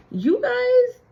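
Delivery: excited high call. Real, not acted. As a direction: call out to the viewers, thrilled. Hyping up her viewers.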